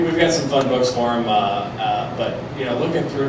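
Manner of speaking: ordinary talk, nothing marked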